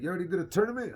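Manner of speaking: high-pitched amazed question. Retold or imitated. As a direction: sound floored that he competed. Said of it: "He's like".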